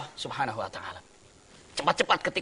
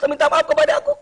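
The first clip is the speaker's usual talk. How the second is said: amazed; 4 dB louder than usual. Acted. Man amazed by Imam's apology.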